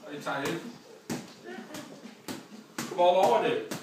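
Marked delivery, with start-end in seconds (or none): 2.8-3.7 shouted push to finish; loud urging. Real, not acted. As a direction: yell to drive them on. Coach pushing tired trainee.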